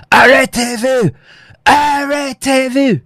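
high, loud barked French command; shouting Arrêtez-vous at a fleeing man; retold police shout. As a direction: yell it like a cop ordering a stop.

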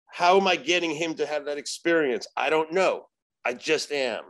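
ordinary delivery

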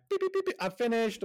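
put-on high, quick kid voice; a bored kid breezing through; breezy kid voice.

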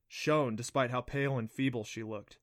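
usual speaking voice

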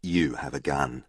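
low, slow and hushed for suspense; grave, soft dramatic reveal; dramatic storytelling. A narrator's reading. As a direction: drop the voice and draw it out. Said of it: "I paused dramatically".

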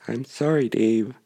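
evenly measured computer voice; Hal. Acted. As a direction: impassive machine refusal. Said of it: "it sounds like Hal".

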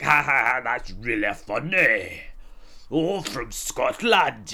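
put-on loud, drawn-out parody of a Scottish accent; mocking the accent. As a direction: echo it back slowly with loud mockery. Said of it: "They'd be like".

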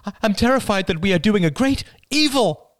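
high, loud terrified plea; mother begging to share rations; forceful pleading. A narrator's reading. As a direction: press hard, frightened. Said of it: "with sudden force".

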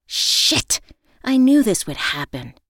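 exasperated storyteller's yell. A narrator's reading. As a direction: curse out loud at your bad luck. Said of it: "I yelled".

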